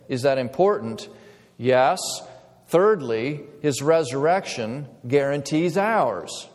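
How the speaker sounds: ordinary delivery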